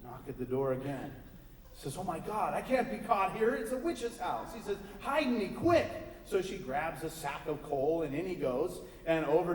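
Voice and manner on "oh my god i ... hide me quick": panicked preacher. Acted.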